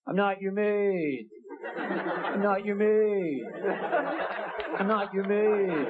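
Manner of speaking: slow, weary nagging mom; a put-upon mother, slowly repeating her complaint; crab lady mom. Retold or imitated. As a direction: drag each word out like a fed-up mother. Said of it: "I'll do for you an impression of my mom".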